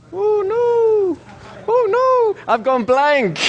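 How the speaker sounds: very high, hammed-up dismay; mock panic. Trainer playing a subject whose mind went blank, mock panic. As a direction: wail it in pretend alarm. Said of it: "I'm freaking out".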